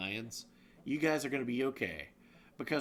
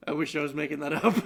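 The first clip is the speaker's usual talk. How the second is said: laughing; 9 semitones higher, 6 dB louder, much faster than usual. Real, not acted. Laughs at story.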